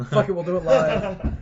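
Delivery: mock TV-host outburst. Laughing co-host quoting a famous on-air rant, mock outburst. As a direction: ham up the famous on-air meltdown.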